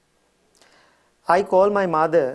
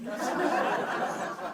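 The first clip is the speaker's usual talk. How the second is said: airy, silent giggle; wheezing laugh at the story; high breathless laugh; 13 semitones higher, 10 dB quieter than usual. Real, not acted. Laughs at sister's retort.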